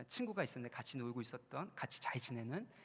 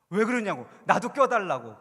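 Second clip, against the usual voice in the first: loud, raised protest; indignant call; 4 semitones higher, 16 dB louder than usual. Retold or imitated. Left-out friend demanding to join, loud protest. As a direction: shout to be let in.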